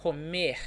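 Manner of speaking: COMER ending in a throaty H; throat-sound R. Shown as a demonstration. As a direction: say "comer" as "co-MEHH". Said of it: "with this throat sound".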